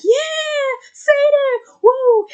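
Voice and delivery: high, loud whooping cheer; cheering cultists. Retold or imitated. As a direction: whoop it up like a frenzied crowd.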